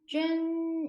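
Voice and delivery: 준 sounded out long and high; syllable model. Shown as a demonstration. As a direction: hold 준 as a lone syllable. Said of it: "이렇게 발음하시면 되고".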